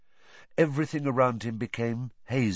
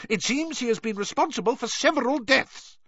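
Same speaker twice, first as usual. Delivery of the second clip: high, pompous self-justification; Fudge blustering. A narrator's reading. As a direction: bluster on in a pitched-up voice to justify yourself. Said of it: "blustered Fudge".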